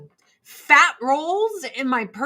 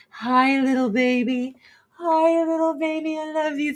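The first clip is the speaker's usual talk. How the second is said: cooing to the cat; 5 semitones higher than usual. Real, not acted. Talking to her cat.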